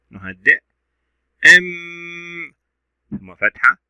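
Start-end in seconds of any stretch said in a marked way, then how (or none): 1.4-2.5 أم with a long, level hummed m; shadda held. Shown as a demonstration. Teacher repeating the shadda for pupils, long held m. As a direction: stretch the m of أم steadily. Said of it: "ننطق الشدة أولًا كما تعودنا".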